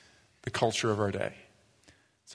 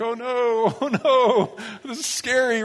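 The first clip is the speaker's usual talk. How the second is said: high, laughing mock panic as a scared kid; mock scared squeal; 10 semitones higher, slower than usual. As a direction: squeal it like a frightened child with a laugh. Child scared of vegetables.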